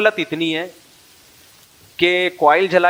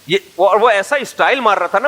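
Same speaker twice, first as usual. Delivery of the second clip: ordinary delivery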